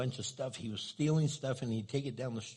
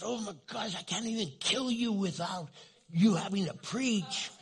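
mock exasperation, pitched higher; exasperated gunman; 9 semitones higher than usual. Gunman fed up with the pastor's preaching, mock exasperation. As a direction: roll the eyes at the preacher.

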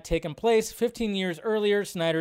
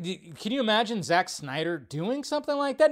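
usual speaking voice

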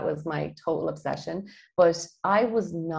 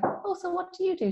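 curious; 14 semitones higher than usual. Retold or imitated. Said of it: "I'm like".

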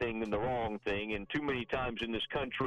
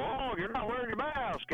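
mocking scold; 8 semitones higher than usual. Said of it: "try to catch people".

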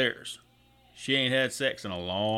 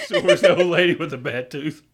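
laughing repeat; 3 semitones higher, 5 dB louder than usual. Real, not acted. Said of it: "repeating the punchline, laughing".

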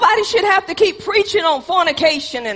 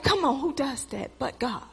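hushed; 4 semitones lower, 12 dB quieter than usual. Real, not acted. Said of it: "preacher's hushed aside".

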